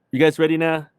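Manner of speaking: ordinary talk, nothing marked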